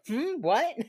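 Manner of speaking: high, loud playing dumb; feigned innocence. Wife refuses to repeat that she was wrong, feigned innocence. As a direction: act like you said nothing.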